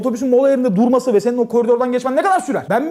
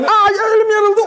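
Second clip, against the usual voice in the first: mock yelp; 9 semitones higher, 4 dB louder, slower than usual. Mock clumsy guest cutting hand.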